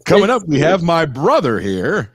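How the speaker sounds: loud, high mock news-anchor tease; hammed-up anchor announcing the next segment; anchor-like kids. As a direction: parody a kid talking like a newsreader. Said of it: "anchor people".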